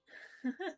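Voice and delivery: a quick, high self-conscious giggle; short laugh. Real, not acted. Streamer laughing at her own tufty hairdo, short high giggle. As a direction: laugh it off, a little embarrassed.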